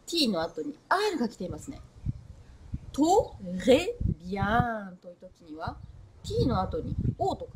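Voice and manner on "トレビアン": "トレビアン" slowly split, with O after T; "トレビアン" drawn out syllable by syllable; exaggerated wrong pronunciation. Shown as a demonstration. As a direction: stretch "トレビアン" into to, re, bian. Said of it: "母音が入っちゃうんですね".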